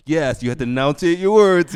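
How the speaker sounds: loud, high teacher insisting; hammed-up classroom instruction; mock teacher voice. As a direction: lay it on like a strict English tutor. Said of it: "I'm like".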